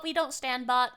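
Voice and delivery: ordinary talk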